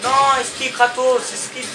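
high, urgent cries at the screen; panicked gamer pleading with his character; urgent game shout. Real, not acted. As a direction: yell at Kratos to dodge now. Urging the game character to dodge.

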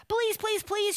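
high-pitched begging shout; loud pleading. A narrator's reading. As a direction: cry out like a cub throwing a fit. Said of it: "shouted the cub so loudly".

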